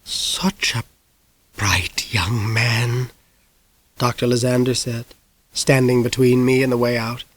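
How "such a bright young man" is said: breathy slow whispered menace; slow sinister whisper; menacing whisper. A narrator's reading. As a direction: whisper it like a predator closing in. Villain's creepy soft praise.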